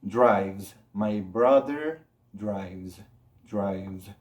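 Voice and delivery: "drives" ending in buzzed V and Z; low, careful "drives" with a Z ending. Shown as a demonstration. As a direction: voice the last sounds of "drives", dryvz. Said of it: "se produce ese sonido de Z".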